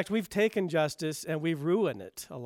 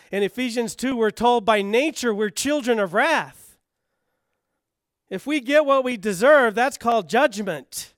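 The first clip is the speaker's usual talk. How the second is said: rising, louder sermon build; preacher driving the point home with force; forceful preaching; 5 semitones higher, 7 dB louder than usual. Acted. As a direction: press each question on the congregation, louder.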